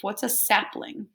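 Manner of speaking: plain reading voice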